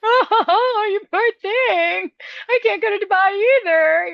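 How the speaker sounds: high, sarcastic pity, then a rushed punchline; mock sympathy. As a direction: coo it with fake pity.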